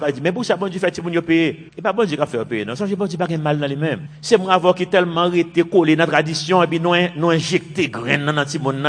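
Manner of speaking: usual speaking voice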